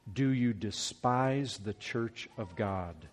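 slow, hushed rebuke, each word weighed; slow and solemn. Shown as a demonstration. Preacher to the church, hushed and slow. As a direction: let the words sink in, quietly and slowly. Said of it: "let these words sink in".

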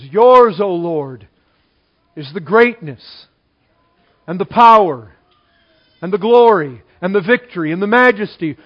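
loud, ringing read of scripture; preacher booming First Chronicles; proclaimed scripture. Acted. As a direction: declare it to the whole hall.

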